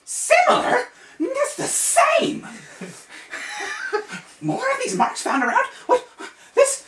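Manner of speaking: very high, loud squeal; astonished wizard. Acted. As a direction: shriek it in disbelief. Saul spins around, astonished.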